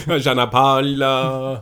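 slow, drawn-out fake Jamaican accent. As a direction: stretch the name in a hammy Jamaican accent. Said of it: "that was a terrible Jamaican accent".

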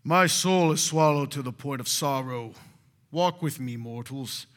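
loud, booming godlike proclamation; hammed-up holy pronouncement; mock grand scripture reading. Shown as a demonstration. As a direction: proclaim it like a glowing deity to mere mortals. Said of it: "you read it like this".

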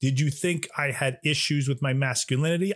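ordinary talk

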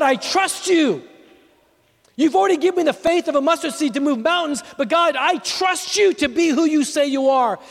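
preaching that climbs to a high, near-shouted prayer; impassioned preaching. Real, not acted. Preacher declaring trust to God before the congregation, building impassioned preaching. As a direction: build into a fervent cry of trust.

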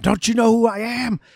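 high-pitched imitation of yelling; mock indignant yell. Arrested old man yelling at Van Damme, high mock outrage. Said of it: "he starts yelling at Van Damme".

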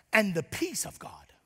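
unvoiced hush on the verse; whispered scripture. Pastor reading the verse to his church, whispered. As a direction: breathe the verse like a secret.

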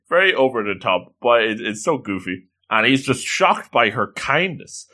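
ordinary talk, nothing marked

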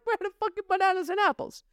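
high, strained put-on voice; whiny exasperated outburst. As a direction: squeal in frustration at the screen. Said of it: "And I'm like".

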